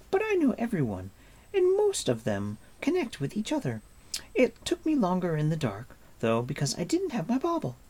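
put-on high, quick boastful girl. A narrator's reading. Narrator voices girl Elanwi.

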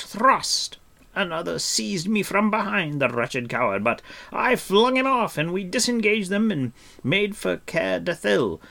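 high, loud heroic boasting; boastful cry. A narrator's reading. Bard brags of fighting to his friends, loud and boastful. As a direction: swagger through the battle tale. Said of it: "he cried".

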